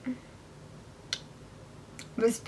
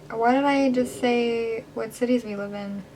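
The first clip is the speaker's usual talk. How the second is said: normal talking voice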